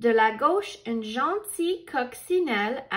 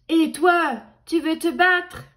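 louder, higher picking a fight; loud high challenge; 4 semitones higher, 7 dB louder than usual. A narrator's reading. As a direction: shout the dare up high. Grumpy ladybug challenges mantis.

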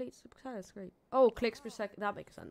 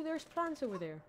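normal talking voice

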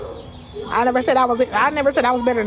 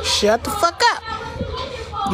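high, slow, soft sing-song; mocking drawn-out retort; 10 semitones higher, 4 dB quieter, much slower than usual. Real, not acted. Streamer sneers at commenters in a high slow drawl. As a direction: stretch it out to mock the haters.

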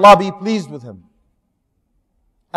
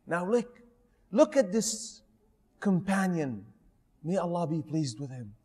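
hushed aside; 4 semitones lower, 16 dB quieter than usual. Real, not acted.

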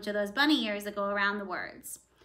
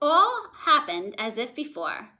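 ordinary delivery

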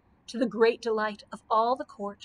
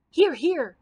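loud, higher cry of approval; cheering cry; 5 semitones higher, 6 dB louder than usual. A narrator's reading. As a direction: call it out over the table. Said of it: "cried the Scarecrow".

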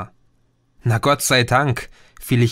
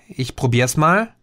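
ordinary delivery

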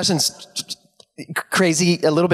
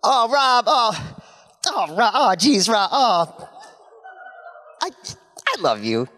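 Kim Rhodes impression; 6 semitones higher, slower than usual.

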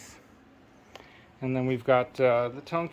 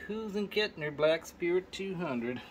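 put-on southern accent; 7 semitones higher than usual. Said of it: "I'm using a southern voice".

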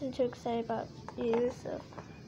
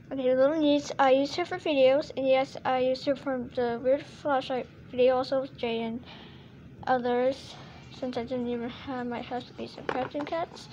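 ordinary talk, nothing marked

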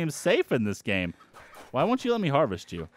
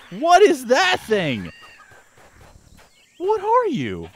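very high, stunned squeal at a weird monster; shocked squeal; 9 semitones higher, 4 dB louder, slower than usual. Real, not acted. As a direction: squeak out the question in disbelief. Sees a strange creature.

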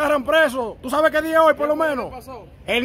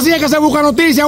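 ordinary talk, nothing marked

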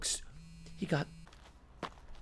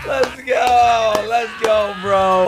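loud, high cheering chant; real hyped cheer; hype shout; 7 semitones higher, 7 dB louder, much slower than usual. Real, not acted. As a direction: pump up the room like a fan. Hyped by character's return.

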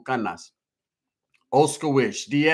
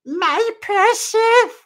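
high, slow creepy creature hiss; high, drawn-out, much louder creepy whisper-croon; golden voice; 8 dB louder, much slower than usual. Pretend. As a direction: croon it like a creature over treasure. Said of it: "Do the golden voice you used to do".

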